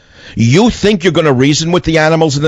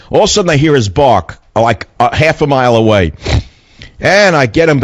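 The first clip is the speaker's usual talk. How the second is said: ordinary delivery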